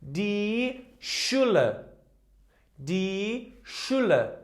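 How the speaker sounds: "Schule" slowly, with a clear sh at the start; slow model word. Shown as a demonstration. Teacher modelling the word school for learners, slowly. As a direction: lean on the "Sch" of "Schule", slowly. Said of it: "it sounds like sh".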